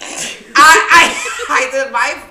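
loud high laughing stammer; confessing she can't say no. Real, not acted. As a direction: laugh through the words. Laughing at her own confession.

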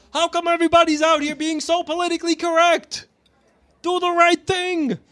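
very high, indignant rant impression; Michael Rappaport. Shown as a demonstration. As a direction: push it up high and stretch the last words. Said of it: "I do an actual good Rapport impression".

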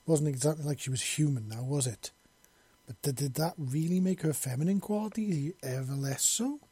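ordinary delivery, nothing marked

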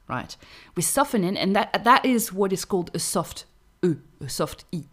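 normal talking voice

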